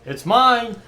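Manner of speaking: high, loud gloating cry; possessive whining. Real, not acted. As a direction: shriek it, greedy and thrilled. Claiming loot, louder.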